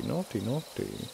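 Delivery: mock scolding. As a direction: wag a finger with a slow playful scold. Teasing about a sexual story.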